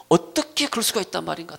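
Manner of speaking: mildly indignant question; disbelieving protest. Acted. Preacher voices the wrong reaction to betrayal, mildly indignant. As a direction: protest it, firmer and fuller. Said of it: "틀리단 말이에요".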